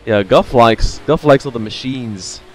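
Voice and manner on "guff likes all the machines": ordinary delivery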